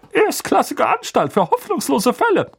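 high, loud smug boast; smug uncle. Acted. Uncle praising the school for hopeless cases, smug.

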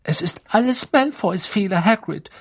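put-on high-pitched, serious girl's voice; high, urgent sincerity; earnest girl. A narrator's reading. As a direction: insist earnestly, pitched well up. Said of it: "sagte Hermine mit ernster Stimme".

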